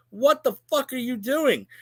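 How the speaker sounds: high, drawn-out exasperated demand; angry promo rant. Real, not acted. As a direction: rant it like an angry New Yorker cutting a promo. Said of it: "I'm cutting a promo right now".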